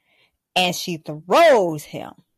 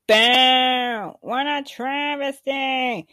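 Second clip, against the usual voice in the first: booing fan; 4 semitones higher, slower than usual. Real, not acted. Booing the show in outrage.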